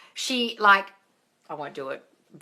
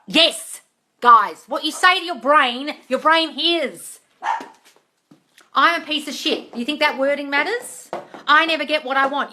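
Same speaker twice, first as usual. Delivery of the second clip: higher, louder preaching rant; shouting; 5 semitones higher, 4 dB louder than usual. Real, not acted. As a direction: drive it into their heads. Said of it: "I love the shouting".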